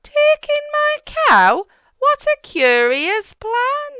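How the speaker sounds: shocked at the odd plan; astonished old lady. Acted. Old lady reacting to the wise man, high astonished squeal.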